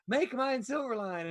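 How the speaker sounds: a light mock kid voice; imitating the kid. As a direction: echo the boy's little voice. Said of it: "And he went".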